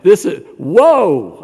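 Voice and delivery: put-on remembering the shock as a young college student, slow whoa; astonished whoa. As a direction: let the whoa hang in shock. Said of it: "I almost dropped my yarmulke".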